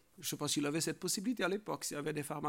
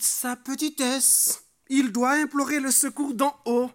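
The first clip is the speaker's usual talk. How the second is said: raised, high, loud sermon voice; full-force preaching; 7 semitones higher, 9 dB louder than usual. Real, not acted. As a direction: drive each point home loudly.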